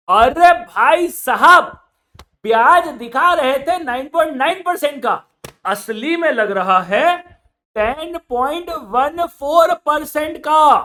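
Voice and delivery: loud, high, slow exclamation; exclaiming. Real, not acted. Teacher showing students the real interest, loudly amazed. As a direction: stress the shock of the real rate.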